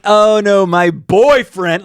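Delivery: high, slowing mock waitress brush-off; a light imitation of a waitress saying no. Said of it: "they're like".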